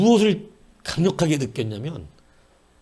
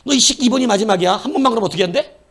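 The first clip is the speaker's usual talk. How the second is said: loud, higher, fast furious threat; angrier final warning; 7 semitones higher, 6 dB louder, faster than usual. Retold or imitated. Parent scolding the repeat offender, loud fast furious warning. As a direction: snap at the kid with real heat. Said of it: "근데 또 그래".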